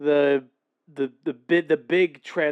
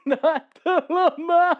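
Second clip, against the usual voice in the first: high, slow, laughing through words; 12 semitones higher, 6 dB louder, slower than usual. Real, not acted. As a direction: squeal it while laughing. Laughing fit.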